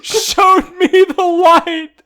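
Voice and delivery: high, loud reading through real laughter; crying with laughter. Real, not acted. Reading Swiper's speech to friends, crying with laughter. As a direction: fight to read while helplessly laughing. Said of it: "I'm crying".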